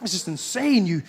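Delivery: normal talking voice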